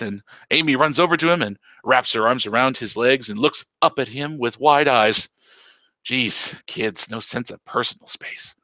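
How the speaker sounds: usual speaking voice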